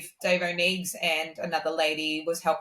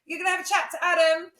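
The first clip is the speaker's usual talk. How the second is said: fast, much higher, businesslike rush; brisk and excited; 12 semitones higher, faster than usual. Retold or imitated. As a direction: rattle it off high and quick. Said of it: "she's like".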